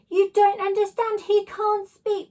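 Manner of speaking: high, loud, slow pleading; a child begging the teacher to listen; desperate outburst. A narrator's reading. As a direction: plead hard to make him understand. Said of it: "I burst out".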